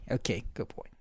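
whispered soothing to the dog; whispered praise. Real, not acted. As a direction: breathe it to the dog. Soothing puppy.

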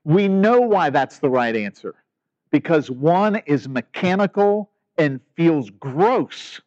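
normal talking voice

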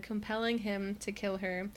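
ordinary talk

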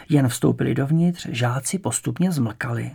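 usual speaking voice

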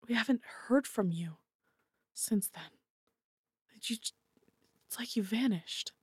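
soft, halting parent; quiet hurt. Acted. Hurt parent confronts returned child.

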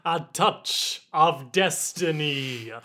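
slow, drawn-out film character voice; crab lady impression. Co-host mimicking a Pirates of the Caribbean character, crab lady impression. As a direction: draw it out like a movie mystic. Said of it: "Racist crab lady from Pirates".

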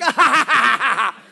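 loud hearty laugh; man in the front row bursts out, hearty laugh; big belly laugh. Retold or imitated. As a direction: laugh like the laugh guy. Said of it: "he's like".

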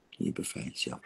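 breathy whispered repeat of "Je bevrijdt jezelf". Shown as a demonstration. Therapist echoing the release to her client in a whisper. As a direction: whisper it close as an echo. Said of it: "En je bevrijdt jezelf".

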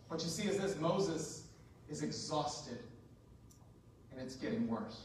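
low, soft, with heavy pauses; grave and hushed. Real, not acted. Preacher telling of exhausted Moses, grave and quiet. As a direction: let it sink down solemnly.

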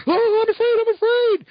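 high mock fearful squeal; mock afraid. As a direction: squeak it like a scared hero, hammed up. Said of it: "Spider-Man gets afraid".

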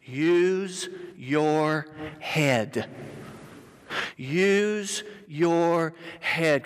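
very slow, each word separate; word by word, drawn out; slow dictation. Real, not acted. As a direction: stretch out every word for note-takers. Dictating three words to write down.

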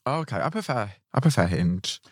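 normal talking voice

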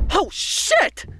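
high startled yelp. Acted. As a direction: jump at the noise. Hears noise in house.

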